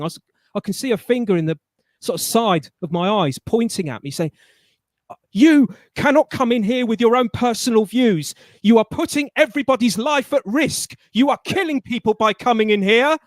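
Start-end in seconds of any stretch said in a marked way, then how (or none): 5.3-13.2 loud, high retold scolding shout; angry shouting. Manager chasing him out the door: loud angry retold shout. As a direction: bark the accusations like the furious manager. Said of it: "she's behind me shouting".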